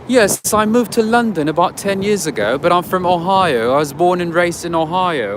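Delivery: mock British customer's accent. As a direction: explain yourself in a put-on British accent. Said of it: "with a British accent".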